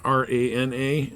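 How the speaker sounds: R A N A spelled letter by letter. Shown as a demonstration. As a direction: spell out R A N A one letter at a time, each letter separate. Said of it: "It's, uh, Rana".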